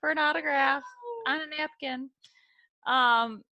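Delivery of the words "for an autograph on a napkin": high, loud call to a crowd; playful high-pitched street-vendor shout; mock hawker. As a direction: call out like you're selling autographs. Jokingly playing a celebrity's hawker.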